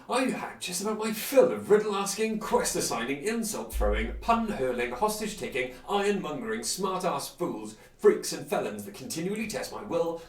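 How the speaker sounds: loud, fed-up tirade; exasperated rant. Acted. Adventurer threatening the riddling hermit, loud and fed up. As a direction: build the threat with barely kept patience.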